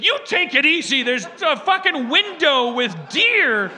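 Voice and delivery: high, loud, slow dog talking back; the dog yells back at his owner; high squeaky dog shouting back, slow at the end; indignant dog voice. Retold or imitated. Dog Harvey voiced snapping back.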